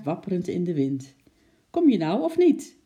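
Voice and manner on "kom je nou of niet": impatient question. A narrator's reading. Lotje calls her dawdling pet impatiently.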